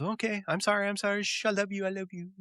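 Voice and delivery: high, soft apologetic hush, acted out; hurried placating. Rachel hushing Phoebe with apologies, high soft placating. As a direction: shush and soothe in a small, high voice.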